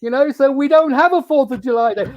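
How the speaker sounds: high, animated mock protest; high-pitched mock outrage; mock indignation. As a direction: protest in comic exasperation, pitched well up. Mock protest to Fourth of July greeting.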